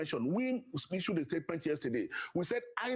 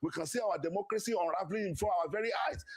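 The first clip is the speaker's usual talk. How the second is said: ordinary talk, nothing marked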